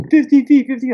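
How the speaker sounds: loud high call from the surface; calling out depth. Retold or imitated. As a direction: shout the depth up to the diver.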